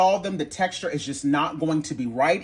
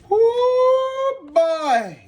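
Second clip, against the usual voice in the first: excited whoop; 5 dB louder than usual. Real, not acted. Reacting to mixed salad.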